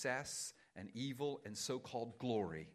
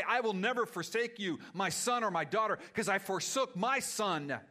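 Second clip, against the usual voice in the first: loud, high, firm promise; God's reassurance; 7 semitones higher, 12 dB louder than usual. Acted. Pastor voicing God to the weary, firm loud promise. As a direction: promise it like a father who won't let go. God speaking comfort to believers.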